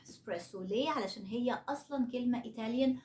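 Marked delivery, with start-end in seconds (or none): 0.0-0.6 low, soft "إسبريسو" as spresso, no k; correct pronunciation. Shown as a demonstration. Teacher confirming the right way, "إسبريسو" low as spresso. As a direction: drop "إسبريسو" quietly, first e very light. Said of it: "ولكن ده النطق الصح".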